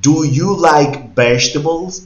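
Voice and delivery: teacher modelling slowly; slow model question. Shown as a demonstration. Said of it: "si yo le digo a usted".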